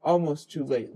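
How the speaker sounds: ordinary delivery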